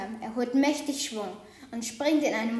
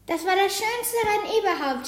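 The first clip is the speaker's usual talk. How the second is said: higher, enthusiastic owl voice; gushing owl; 6 semitones higher than usual. A narrator's reading. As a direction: rave about the race. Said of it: "schwärmt die Eule". (schwärmende Eule)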